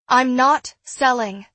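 loud, slow and firm; loudly. Acted. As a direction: declare it loudly. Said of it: "I said loudly".

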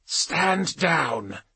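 slow, high, measured order; commander on vox. A narrator's reading. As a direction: issue a slow command over the radio. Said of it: "spoke into her vox bead".

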